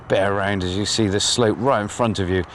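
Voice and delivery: usual speaking voice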